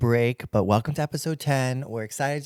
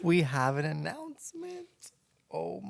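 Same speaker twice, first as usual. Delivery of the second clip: laughing; 4 semitones higher, 7 dB quieter than usual. Real, not acted. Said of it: "echoes the announcement teasingly".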